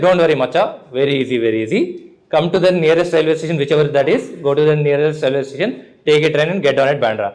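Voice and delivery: low, easy, dismissive reassurance; laid-back South Indian friend. Retold or imitated. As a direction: drop low and shrug it off. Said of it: "He said".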